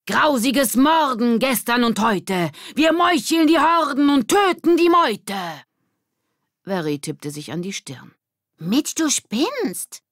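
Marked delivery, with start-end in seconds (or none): 0.1-5.4 very high, loud bellowed rhyme; roaring. Acted. Mitch bellowing his bloody rhyme at the group. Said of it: "erscholl Mitchs Gebrüll". 8.6-10.0 very high, slow, drawn-out; mocking. Verry telling Mitch he's crazy, drawn-out mock.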